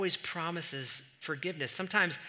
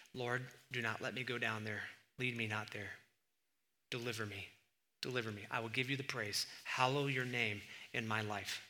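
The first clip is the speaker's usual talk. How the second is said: hushed prayer; 4 semitones lower, 8 dB quieter than usual. Acted. Prayer spoken at sermon start.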